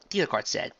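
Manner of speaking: plain reading voice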